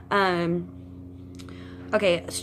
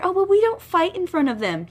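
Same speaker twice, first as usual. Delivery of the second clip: much higher, louder whiny mocking voice; mock parent excuse; 12 semitones higher, 5 dB louder than usual. Mocking unhappy parents who claim they never fight in front of kids, whiny. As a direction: sneer it like a smug parent making excuses.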